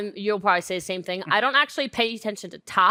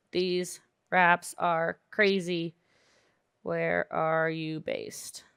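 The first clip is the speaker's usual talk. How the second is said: dictating slowly; much slower than usual. Shown as a demonstration. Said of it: "you could message somebody like this and say".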